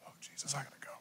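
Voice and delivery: hushed, breathy aside; whisper. Real, not acted. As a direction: drop to a whisper, just for the front row. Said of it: "aside whispered".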